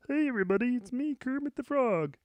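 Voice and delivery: high, throaty frog puppet voice; Kermit the Frog. Shown as a demonstration. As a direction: do a cheerful puppet frog greeting. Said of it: "A plus Kermit the Frog".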